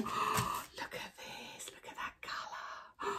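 slow breathy whisper of delight; awed whisper. Real, not acted. Knitter marvelling at new yarn to viewers, whispered. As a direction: hush it like you can't believe it.

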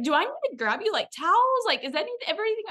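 imitating a frantic helper, pitch shooting up; flustered barista. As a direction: offer help in a high, mock fluster. Retelling barista's flustered offer of help.

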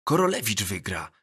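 understated gravity; serious voice. A narrator's reading. Krowa predicting the lottery winner to his classmates, mildly solemn. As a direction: say it in all seriousness. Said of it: "powiedział poważnym głosem Krowa".

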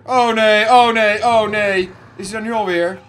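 loud, high, frantic "oh nee"; real fright, yelling at the screen; panic. Real, not acted. Panics as monster returns. (luide paniek)

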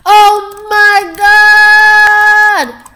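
long, high full-volume scream; huge drawn-out high scream. Real, not acted. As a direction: scream it out, stretching every word. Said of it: "for screaming".

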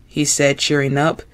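usual speaking voice

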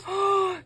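sharp, high-pitched gasp; astonished gasp. Real, not acted. Floored by a stranger's gift, an astonished gasp. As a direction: gasp hard in real disbelief.

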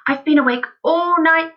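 high, loud burst of excitement; excited cry. A narrator's reading. Excited father bursting in at breakfast, crying out. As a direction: cry it out, thrilled with the idea. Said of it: "he cried".

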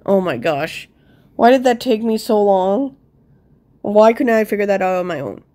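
ordinary talk, nothing marked

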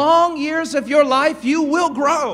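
loud, high preaching build; full-force revival preaching. Acted. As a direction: preach it with rising fire.

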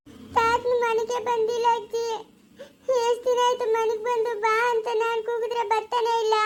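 put-on high, childlike complaining voice; little granddaughter. Granddaughter telling grandma her grandpa stays away, childlike whine.

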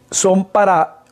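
normal talking voice

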